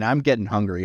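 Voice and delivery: ordinary talk, nothing marked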